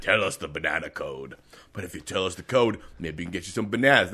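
good cop voice. Acted. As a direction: coax gently like a good cop. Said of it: "good cop".

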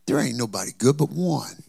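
ordinary talk, nothing marked

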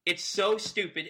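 really fed up, hammering it home; exasperated rant. Real, not acted. Host rants about a dumb rule change, exasperated.

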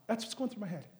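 ordinary talk, nothing marked

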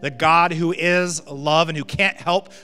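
loud, building to a high peak; impassioned preaching. Real, not acted. Preacher urging church to tap into love's source, impassioned. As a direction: preach it with mounting force.

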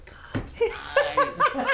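giggling through the words, high; laughing. Real, not acted. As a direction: laugh it out, a bit guilty. Laughing at guilty pleasure.

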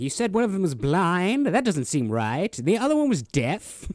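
high, loud mock British delivery; imitated film character. Friend showing how the actor talks all movie, high exaggerated character voice. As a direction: push the actor's odd voice up high. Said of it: "how he talks for the entire movie".

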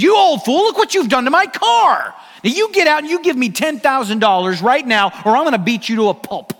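loud, high, furious shouting; angry driver yelling at an old man. Retold or imitated. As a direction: bark at the old fool like an enraged driver.

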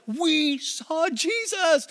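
high, long drawn-out cheer; sing-song jubilant cry; ecstatic announcement. Acted. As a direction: stretch every word in giddy excitement. Disciples return with thrilling news.

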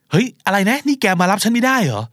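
high-pitched, dismayed protest; incredulous complaint. Acted. Stranded caller asks why friend cannot come, incredulous complaint. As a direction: protest in disbelief, pitched well up.

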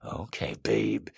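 low, very soft murmur; whispering lover. A narrator's reading. Man to his lover, low stage whisper. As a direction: drop low and hushed, slowly. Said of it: "he f-, whispers".

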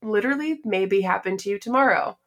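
ordinary talk

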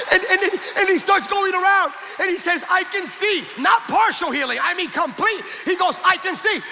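high-pitched, fervent narration; impassioned preaching. A narrator's reading. Preaches the climax at force.

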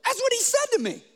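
high-pitched amazed question; incredulous. Real, not acted. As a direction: make them lean in with disbelief. Preacher stressing shocking reply.

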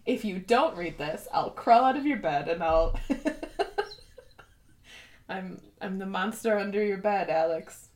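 playful mock villain threat; hammed-up monster under the bed; creepy playful under bed whisper. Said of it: "I could pull a Kaz".

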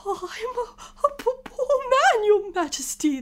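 high, tearful, stammering plea; trembling voice. Acted. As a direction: stammer and wail it like a terrified witness. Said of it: "began in a trembling voice".

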